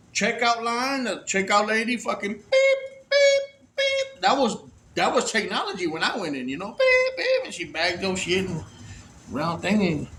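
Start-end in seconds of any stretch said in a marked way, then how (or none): 3.1-4.2 very high electronic beep, beep; scanner beeps. Retold or imitated. Imitating the cashier's scanner with high beeps. 6.5-7.2 high chirpy beep with a laugh; scanner beep. Retold or imitated. Laughing while mimicking the register beep.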